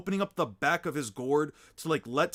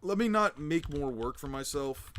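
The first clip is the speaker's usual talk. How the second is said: ordinary talk, nothing marked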